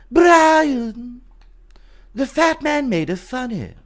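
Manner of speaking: high-pitched cartoon character voice; put-on high cartoon voice; Family Guy impression. Shown as a demonstration. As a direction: call the name long and high, then comment. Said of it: "What's your best Family Guy impression?".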